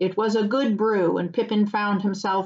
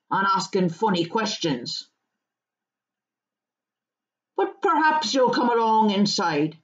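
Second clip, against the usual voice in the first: rustic, easygoing storyteller turning host; country farmer, hospitable. Acted. Farmer inviting hobbits in for ale, rustic and hospitable. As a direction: talk like a farmer inviting guests in.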